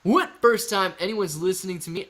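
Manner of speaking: ordinary talk, nothing marked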